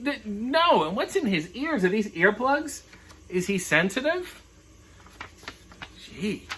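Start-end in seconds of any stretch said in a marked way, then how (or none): none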